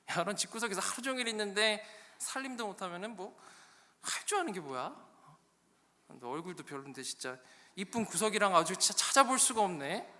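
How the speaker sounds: hammed-up domestic nagging; scolding husband. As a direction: lay into her, acting annoyed. Acted husband scolding his wife.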